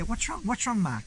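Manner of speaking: put-on Irish accent, asking with worry; Irish lady. Lady asking worried Mark what's wrong, Irish accent. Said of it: "she was an Irish lady".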